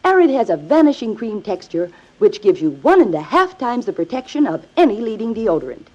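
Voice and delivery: ordinary talk, nothing marked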